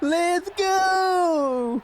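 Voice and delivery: long, drawn-out high cheer; whooping, slow high yell of triumph; excited shout. Real, not acted. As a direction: holler it out high, stretching the words. Cheering the game on screen.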